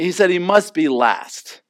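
usual speaking voice

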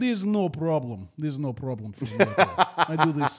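lightly played Russian salesman, laughing through it; easygoing mock Russian, a chuckle under it; Russian accent. Car salesman reassuring a buyer, mock Russian accent. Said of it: "do my Russian accent".

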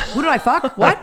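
high, laughing, shocked question; shocked disbelief. Retold or imitated. Hearing rumour on radio.

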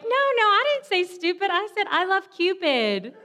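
high, hammed-up innocent voice, laughing at the end; panicked denial. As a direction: fake a guilty cover-up, squeaky and exaggerated. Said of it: "I was like".

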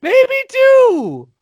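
very high, loud squeal; excited echoing shout. Real, not acted. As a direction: echo it back at the top of the range.

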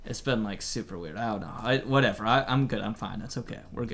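low, deep male voice on radio; Fredericks voice. Acted. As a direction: drop low as Fredericks on walkie talkie. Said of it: "I have his voice".

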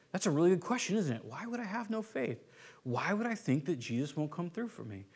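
soft, quiet self-questioning; inner musing. Retold or imitated. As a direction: muse to yourself, hushed. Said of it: "a question that would cause us to look inward".

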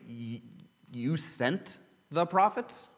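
baffled disbelief. Acted. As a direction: stop and question it, pitch climbing in disbelief. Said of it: "you wanna stop and say".

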